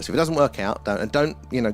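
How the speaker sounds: ordinary talk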